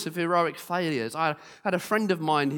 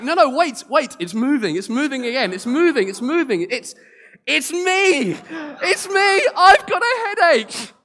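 much higher and louder, near a wail; frantic climax; 10 semitones higher, 9 dB louder than usual. Retold or imitated. Lady on stage frantically realizing she has the headache, near wailing. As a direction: spiral up into a hysterical realization.

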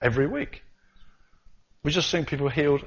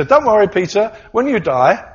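Jesus to Peter; 9 dB louder than usual. Retold or imitated. Jesus mock-reassuring Peter about heaven, hammed-up and loud. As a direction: boom it like a cheerful host promising a room. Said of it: "So he's saying to Peter".

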